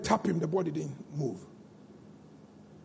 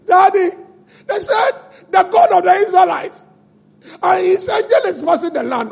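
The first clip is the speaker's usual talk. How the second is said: higher pleading son's voice; 8 semitones higher than usual. Acted. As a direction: voice the boy calling his father. Son voiced talking to Pharaoh.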